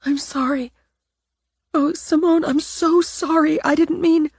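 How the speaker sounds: high, pleading, near tears; horrified apology. Acted. Natalie pleading with Simone after breaking the statue, high and tearful. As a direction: beg forgiveness in a thin high voice.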